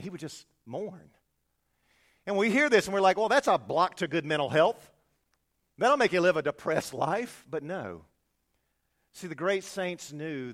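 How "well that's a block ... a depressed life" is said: loud scoffing dismissal; mock skeptic. To the congregation, mocking modern skeptic, loud and scoffing. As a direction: scoff loudly at the idea.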